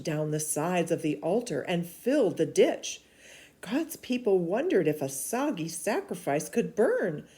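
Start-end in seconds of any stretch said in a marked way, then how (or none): none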